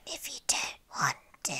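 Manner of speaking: hammed-up hoarse whisper of a sick man; Daniel impression. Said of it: "That's my impression of Daniel right now".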